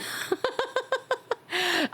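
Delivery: shrill breathy giggle; high giggle. Real, not acted. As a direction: squeak out a laugh. Laughing at yelling comment.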